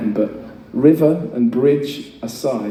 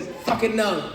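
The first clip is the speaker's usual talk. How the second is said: mock diva stamp; 10 semitones higher, 6 dB quieter, slower than usual. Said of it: "So I stamped my feet".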